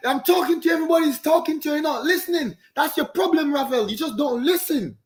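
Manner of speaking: mock yell. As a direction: bark it at him like a fed-up coach. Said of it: "it's like".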